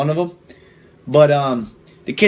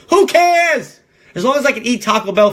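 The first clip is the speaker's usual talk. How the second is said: much higher, louder put-on voice, mocking; mocking dismissive voice; 8 semitones higher, 3 dB louder than usual. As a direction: squeal it like a carefree airhead. Mocking a dismissive political attitude.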